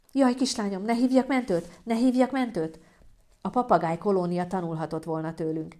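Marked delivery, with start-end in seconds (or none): 0.1-2.8 higher, fretful repetition; panicky mother. Acted. Mother asking about calling an ambulance, panicky. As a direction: fret helplessly over your daughter.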